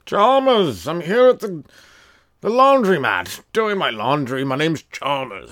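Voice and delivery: high, slow, drawn-out fancy gent; hammed-up posh name-dropping. Homeless man at the laundromat gives his name, very fancy. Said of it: "Very fancy".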